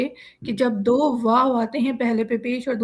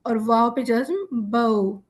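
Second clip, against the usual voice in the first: slow spelling out; much slower than usual. Shown as a demonstration. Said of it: "ہجے کس طرح ہوگی؟".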